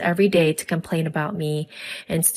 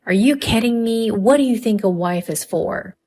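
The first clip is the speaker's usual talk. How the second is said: louder, higher outraged rebuke; angry yelling; 4 semitones higher, 4 dB louder than usual. A narrator's reading. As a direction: raise your voice in indignant disbelief. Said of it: "my father-in-law's angry voice rang out".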